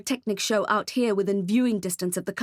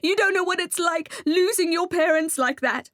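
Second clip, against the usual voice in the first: sobbing; 8 semitones higher than usual. A narrator's reading. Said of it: "I started sobbing".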